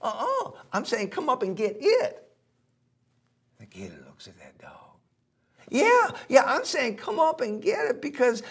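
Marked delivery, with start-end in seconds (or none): 0.0-2.3 high, singsong taunt from a cheeky dog; cocky little dog calling down; teasing dog voice. Acted. Teasing dog talks to gator. 5.7-8.4 high, singsong taunt from a cheeky dog; cocky little dog calling down; teasing dog voice. Acted. Dog keeps taunting the gator.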